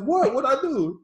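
high, loud mock protest; startled innocence. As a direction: play the caught guy acting innocent. He's like.

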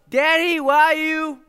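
put-on high, drawn-out little girl's call; toddler calling out. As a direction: call out sweetly like a two-year-old looking for daddy. Toddler calling dad in shower.

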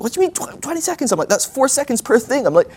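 high-pitched mock panic; panicked squawking at an impossible deadline; freaking out. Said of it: "I'm just freaking out".

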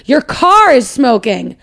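slow, deliberate warning. Retold or imitated. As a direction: spell out the danger slowly. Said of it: "the guy goes".

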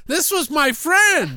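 high, drawn-out comic wail; hammed-up high-pitched lament; mock protest. Podcaster plays a bereaved friend to Mary Lou, mock wailing. Acts out the film character's protest.

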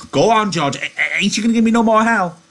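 high, loud, stammering urging; eager. A narrator's reading. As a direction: beg excitedly like a simple big child. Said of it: "Lenny looked eagerly at him".

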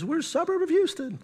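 high-pitched mimicked suburbanite; suburban newcomer. Pastor imitating residents who call the town a suburb, high-pitched voice.

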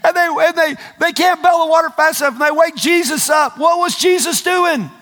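high, impassioned preacher shout; high-pitched pulpit delivery; full-force preaching. Real, not acted. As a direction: preach it with mounting incredulity.

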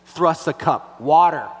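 slow, loud mock demand, shoving a cup out; hammed-up bossy kid, barking the last word; imitated child bluntly demanding water; rude demand.

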